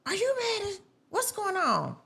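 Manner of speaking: imitating mom, high-pitched questioning; mom's voice. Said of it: "She was like".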